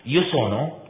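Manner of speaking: ordinary delivery, nothing marked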